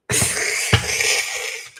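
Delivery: breathy, wheezing giggle fit; helpless wheeze of laughter; uncontrolled laugh. Real, not acted. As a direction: crack up, almost soundless. Tickled mid-reading.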